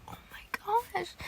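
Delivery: high, soft awed whisper; breathless whisper. Retold or imitated. Fan melting over a sweet scene, awed whisper. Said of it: "I'm like".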